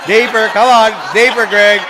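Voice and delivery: loud and high, urging through laughter; laughing. Real, not acted. Coaching Greg mid-laugh.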